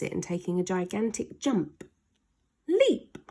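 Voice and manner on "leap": high, loud "Leap!" shouted out; sound effect call. A narrator's reading. Narrator calling the leap, high and loud.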